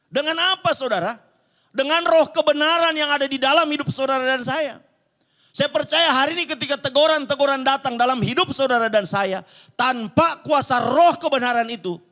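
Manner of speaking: loud, high-pitched sermon delivery; full-force preaching. Real, not acted. Preacher urging believers back to Jesus' teaching, full-force preaching. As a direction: preach it out at the top of your voice.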